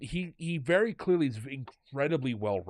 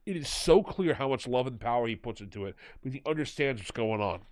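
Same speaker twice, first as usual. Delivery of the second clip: ordinary delivery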